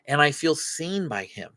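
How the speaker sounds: ordinary talk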